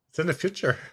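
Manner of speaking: laughing through the words; giggling while explaining; cracking up mid-line. Real, not acted. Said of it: "I'm laughing at my own maliciousness".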